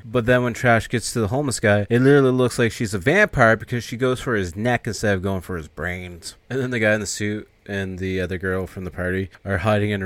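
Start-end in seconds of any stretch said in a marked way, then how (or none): none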